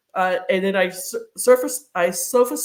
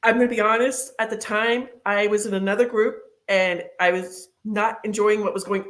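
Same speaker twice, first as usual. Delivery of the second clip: usual speaking voice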